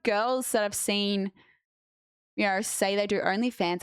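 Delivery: usual speaking voice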